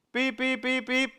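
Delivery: high-pitched beep imitation; timer beeps. Preacher imitating the work alarm for listeners, high beeping. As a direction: mimic short repeated alarm beeps.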